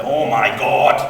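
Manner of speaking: lightly voiced excited uncle; a hint of Uncle Mike's excited exclamation; Uncle Mike catchphrase. Nephew imitating his uncle's excited catchphrase, lightly voiced. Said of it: "you would hear him say".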